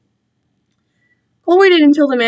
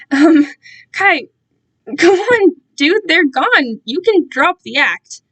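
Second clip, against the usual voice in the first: nervous urging; 5 semitones higher, 3 dB louder, slower than usual. Acted.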